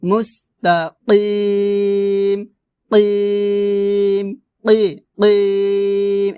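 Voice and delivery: "কিম" with throaty q and very long held ee; qaf-initial "কিম", slowly sustained; deep qaf sound. Shown as a demonstration. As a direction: draw out "মুস্তাকিম" on a steady note. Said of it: "এভাবে".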